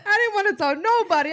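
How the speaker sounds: high, loud, laughing through the words; laughing embarrassed. Real, not acted. Admitting to the listeners she made a scene, laughing.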